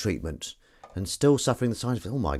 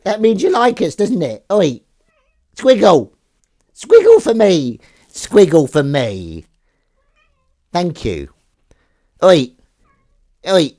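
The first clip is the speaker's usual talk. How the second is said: coaxing the cat; 10 semitones higher, 13 dB louder, slower than usual. Real, not acted. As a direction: sweet-talk the cat.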